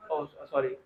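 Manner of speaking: ordinary delivery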